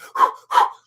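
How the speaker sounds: loud breathy gasp, imitated; sharp puffs like a breath-holding diver; gasp for air. Man holding his breath in a porta potty, gasping.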